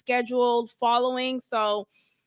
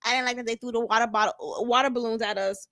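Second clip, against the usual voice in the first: imitating a sulky complaint, softer; a lightly mocked whine; mock pouty voice; mocking whiny attitude; 5 semitones lower, 5 dB quieter, faster than usual. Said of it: "got an attitude talking about".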